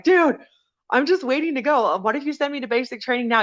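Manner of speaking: high-pitched exasperated appeal; mad, pleading protest. Retold or imitated. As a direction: protest like you can't believe it. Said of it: "I was so mad".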